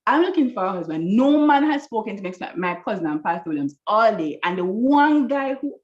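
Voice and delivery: exasperated retold complaint, starting high; annoyed with God. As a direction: rant at God in disbelief, emphatic then settling. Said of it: "I was annoyed with God".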